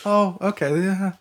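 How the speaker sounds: ordinary talk, nothing marked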